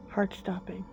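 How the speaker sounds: ordinary delivery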